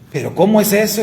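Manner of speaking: astonished question. Retold or imitated. Friend still stunned, asking how it happened, high and amazed. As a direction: pitch it up in amazement.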